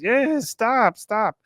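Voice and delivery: high, mocking stop stop; high sarcastic mimicry; mocking echo.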